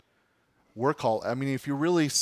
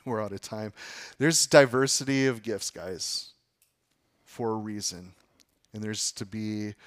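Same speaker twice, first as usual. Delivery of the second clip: usual speaking voice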